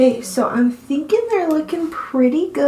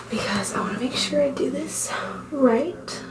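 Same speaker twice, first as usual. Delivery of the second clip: whispering; 8 dB quieter than usual. Real, not acted. Concentrating whisper while applying liner.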